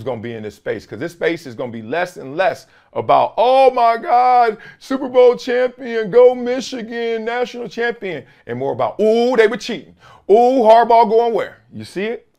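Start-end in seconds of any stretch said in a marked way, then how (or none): 3.4-8.2 high, loud, drawn-out mock fan excitement; mock hype. Mimics excited sports talk being dismissed. 9.0-11.6 high, loud mock gossip; mock scandalized. Mimics sports gossip being dismissed.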